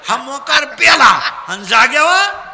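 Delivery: loud, projected storytelling to a crowd; full-force comic preaching. Real, not acted. Preacher telling children of a man's downfall, full-force comic preaching. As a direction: drive the tale up to an exclaimed punchline.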